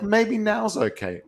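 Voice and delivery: high, slow, tentative pet voice; pet's sneaky thought. Retold or imitated. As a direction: voice the pet testing its luck, drawn out. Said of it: "It'll be like".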